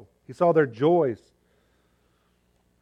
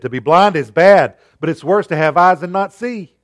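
forceful quote; 4 semitones higher, 9 dB louder than usual. Acted. Quoting Helen Keller forcefully.

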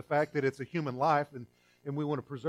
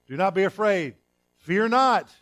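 angelic proclamation; 4 semitones higher, 8 dB louder than usual. Retold or imitated. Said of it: "How do they address whoever they're coming to?".

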